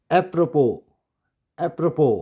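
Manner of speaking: Apropos as ep-ro-PO; silent S. Shown as a demonstration. Teacher models the word twice, silent S. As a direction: say apropos ending on open o. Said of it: "इसमें एस silent है".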